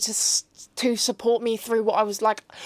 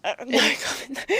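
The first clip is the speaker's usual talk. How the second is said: laughing. Real, not acted. Guest dodges question, laughs.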